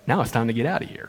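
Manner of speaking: low, quick undertone; hushed, hurried aside to oneself; sneaking off. Acted. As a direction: say it fast and under your breath. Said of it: "Now she's like".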